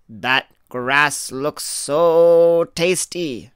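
slow, loud, wide-eyed little goat. Acted. Littlest goat eyeing the grass across the river, longing. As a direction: savour the grass like a hungry kid goat. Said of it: "said the littlest billy goat Gruff".

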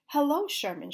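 ordinary delivery

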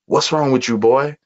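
lightly voiced Southern grandpa asking; concerned Southern grandfather voice; Southern accent. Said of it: "in this very Southern voice".

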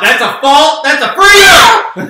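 high, loud outraged protest; excited shout. Real, not acted. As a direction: cry foul at the top of your voice. Protest during rock paper scissors.

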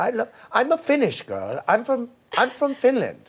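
high put-on Dutch-ish accent, played for laughs; Yolanda impression. Host asks for an impression, mock Dutch accent. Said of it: "can I do a Yolanda impression".

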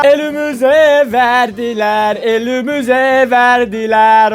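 slow, dragged-out mock despair; mock lament. Vlogger to viewers about the pump: slow, hammed-up lament. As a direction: moan it like a tragedy.

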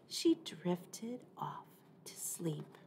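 soft whispered ending; whispering. A narrator's reading. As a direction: whisper them off to sleep. Story ending, falling asleep.

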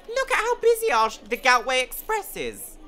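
high, loud mock guest voice; park guests bragging. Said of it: "All the people going".

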